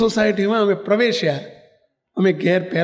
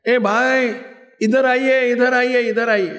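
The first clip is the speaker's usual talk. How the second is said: old woman's high-pitched call re-enacted; raised, insistent mock holler; 6 semitones higher, slower than usual. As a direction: imitate hailing someone over, pitched up. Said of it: "બૂમ પાડી".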